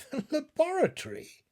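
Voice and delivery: high, baffled echo of the word; a puzzled question. A narrator's reading. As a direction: repeat it as if it makes no sense. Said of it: "he answered in a puzzled tone".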